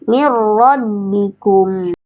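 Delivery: "Mir rabbikum" drawn out slowly with a long held "mir"; slow tajwid demo. Shown as a demonstration. Teacher slowly demonstrating recitation to a student, drawn out. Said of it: "tafkhim, ya, tebal".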